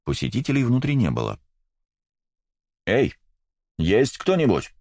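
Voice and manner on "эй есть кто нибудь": calling out. A narrator's reading. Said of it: "крикнул он".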